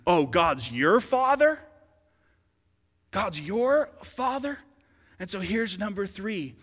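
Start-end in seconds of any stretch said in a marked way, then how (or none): 0.1-4.6 loud, drawn-out scoffing question, rising high; mock incredulous. Persecutors challenge Jesus's claim, mock incredulous. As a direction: challenge it slowly in raised, disbelieving pitch.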